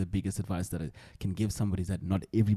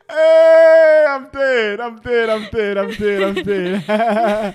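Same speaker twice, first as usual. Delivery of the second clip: high-pitched helpless laughter, loud; laughing so hard he can barely talk; 12 semitones higher, 14 dB louder, much slower than usual. Real, not acted. Cracking up at braai joke.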